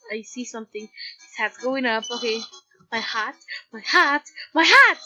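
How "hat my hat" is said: shouting. Real, not acted. Alarmed shout at game.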